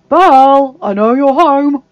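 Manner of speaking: loud, drawn-out call through a door; man demanding to be let in. A narrator's reading.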